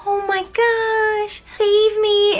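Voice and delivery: high, slow damsel plea. Retold or imitated. Clara begging the nutcracker, in a high swooning plea. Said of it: "she's just like".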